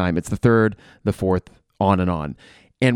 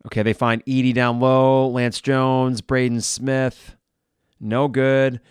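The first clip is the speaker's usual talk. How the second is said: ordinary delivery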